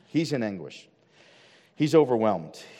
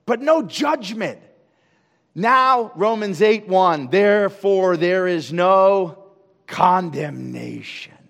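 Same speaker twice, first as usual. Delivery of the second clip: full-force preaching; 6 semitones higher, 9 dB louder than usual. Acted. Preacher calling out to his church in full-force preaching. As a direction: drive it home from the pulpit.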